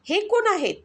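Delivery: high-pitched, startled question; heart-pounding alarm. A narrator's reading. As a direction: ask in alarm, pitch leaping up. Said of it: "हृदय जोरानं धडधडू लागलं".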